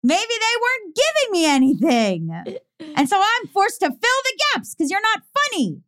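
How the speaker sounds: drawn-out, rising mock vindication; hammed-up self-justifying outburst; dawning realization. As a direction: build from a slow realization to high indignation.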